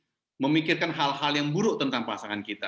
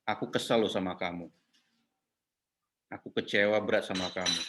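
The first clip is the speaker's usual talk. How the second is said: low voice, falling tune on each line; wife sharing her hurt, low and falling; vulnerable, falling tone; 7 semitones lower than usual. Shown as a demonstration. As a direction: share hurt openly, letting the pitch sink. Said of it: "sudah berbicara dengan vulnerable, dengan intonasi ke bawah".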